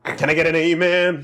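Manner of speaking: high revival-meeting call; preacher. Acted. Host riffing to co-hosts, as a preacher. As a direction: call to the congregation for a response. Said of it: "in a Presbyterian preacher's kind of voice".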